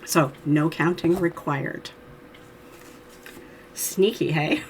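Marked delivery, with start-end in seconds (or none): none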